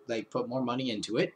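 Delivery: ordinary talk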